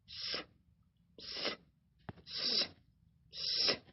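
soft hushing "sh" in separate puffs; quiet, repeated shushing; imitated wind. Shown as a demonstration. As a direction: make the wind's rustle with Ш.

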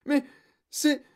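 halting, high, loud cry; squeaking, alarmed pupil; panicked exclamation. A narrator's reading. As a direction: stumble into a frightened protest. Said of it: "s'écria Guillaume".

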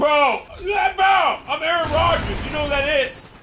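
high, exasperated shout; exasperated yelling. Real, not acted. Insists he is Aaron Rodgers.

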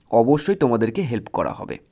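ordinary delivery

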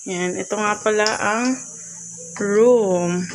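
normal talking voice

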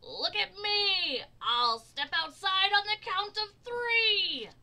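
high, loud show-off shout; storybook character crowing for attention; excited shout. A narrator's reading. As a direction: shout it gleefully, stretching the first words. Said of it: "He twirled and shouted".

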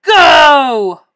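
huge drawn-out starting shout; booming, long-held race command; roar. A narrator's reading. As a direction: roar it like a coach. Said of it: "roared the coach".

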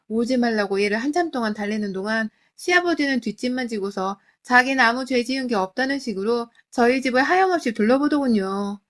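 plain reading voice

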